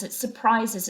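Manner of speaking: ordinary delivery, nothing marked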